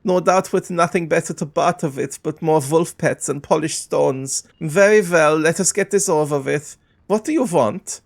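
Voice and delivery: haughty merchant in a put-on foreign accent; disdainful shopkeeper. Shopkeeper greeting unwelcome barbarians, disdainful accented voice.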